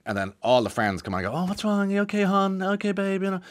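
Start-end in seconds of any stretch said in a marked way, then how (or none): 1.4-3.4 sugary cooing, pitched much higher; a sarcastic send-up of online sympathy, high and sweet; mock gushing sympathy. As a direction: coo it like a fawning friend. Friends fussing online, mocked.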